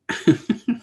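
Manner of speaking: high burst of laughter; amused laugh. Real, not acted. Laughing at the old boss's texted reply, amused. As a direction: laugh at your own punchline.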